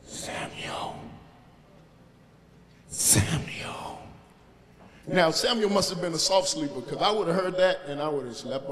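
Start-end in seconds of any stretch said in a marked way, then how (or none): 0.1-1.0 breathy whisper, softly calling a sleeper; whispered divine call. Acted. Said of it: "he heard a voice". 2.9-4.0 whispered divine call. Acted. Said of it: "he heard a voice".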